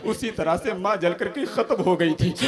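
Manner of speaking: usual speaking voice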